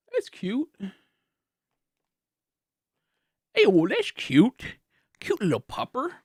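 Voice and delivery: soft, high cooing over a puppy. Real, not acted. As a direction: coo it like talking to a pet.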